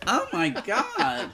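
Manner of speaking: slow, high laughing gasp; scandalised giggle. Real, not acted. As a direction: stretch it out through laughter. Laughing at teacher joke.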